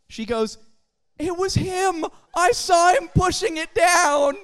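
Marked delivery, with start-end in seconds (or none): 1.2-4.4 put-on higher and louder, a kid telling on him; sing-song tattle like a small child; loud, high little-girl accusation; tattling little girl. Five-year-old girl telling on Joe.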